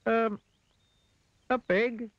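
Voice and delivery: very high, uncertain figure voice; Mister Dizzy. A narrator's reading. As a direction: pipe up hesitantly with a guess. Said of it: "replied Mister Dizzy".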